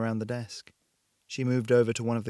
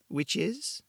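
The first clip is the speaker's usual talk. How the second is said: put-on vague European accent; 7 semitones higher than usual. A narrator's reading. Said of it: "Her accent was impossible to place".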